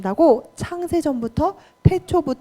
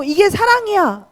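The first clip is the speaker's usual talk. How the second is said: higher-pitched mock insistence; mistaken assertion; 8 semitones higher than usual. Preacher voicing a bully calling it love, higher insistent mock claim. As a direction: insist on it as a misguided person would.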